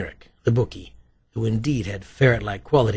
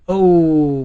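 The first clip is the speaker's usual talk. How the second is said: long, loud falling moan; theatrical moan; 5 semitones higher, 10 dB louder than usual. A narrator's reading. Writer hears the right guess, moaning. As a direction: draw out a high, loud groan of regret. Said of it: "moaned Rook".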